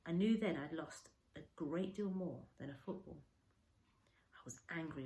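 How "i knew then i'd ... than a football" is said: usual speaking voice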